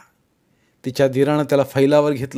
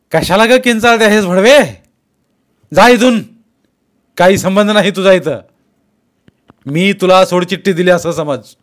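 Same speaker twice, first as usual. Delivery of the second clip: loud, higher abusive shout; furious yell; 7 semitones higher, 11 dB louder than usual. Acted. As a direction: roar her away from the door. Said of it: "तो ओरडला".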